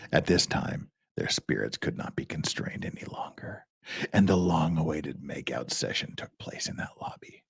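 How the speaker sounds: low dramatic voice, hushed then building to a roar; Bone Steel. Shown as a demonstration. Reader gives the absurd sentence a special reading, mock-dramatic. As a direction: start near a whisper, explode on the last words. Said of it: "we had to do the Bone Steel voice".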